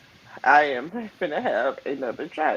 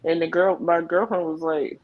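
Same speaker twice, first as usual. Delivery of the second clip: normal talking voice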